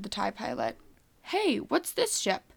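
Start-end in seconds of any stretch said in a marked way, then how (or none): 1.2-2.4 higher, drawn-out surprised question; puzzled surprise at a sudden sight. A narrator's reading. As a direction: lift the pitch in slow surprise.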